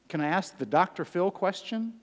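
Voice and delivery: ordinary delivery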